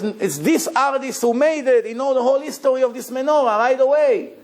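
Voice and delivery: high, excited voice of an astonished collector; amazed collector. Retold or imitated. Collector stunned by rare menorah.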